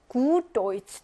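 "Gut" with a long drawn-out u; long vowel. Shown as a demonstration. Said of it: "길게".

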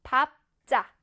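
밥 자 as [밥짜], two separate syllables; read-along pronunciation. Shown as a demonstration. Teacher leading pupils reading aloud, read-along pronunciation of 밥 자. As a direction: lead pupils through 밥, then 자 tensed like 짜. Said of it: "자, 읽겠습니다".

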